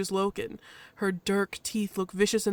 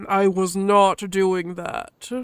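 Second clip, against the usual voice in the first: slow, louder, weighty denial; gruff husky Loken; 7 dB louder, much slower than usual. Acted. Loken answers the badger, gruff and firm. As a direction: answer slowly and firmly.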